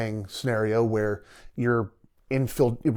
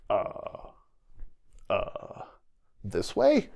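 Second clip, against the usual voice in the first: imitated clueless hesitation; 4 dB quieter, much slower than usual. Lost guide to his team in a blizzard, mock hesitation.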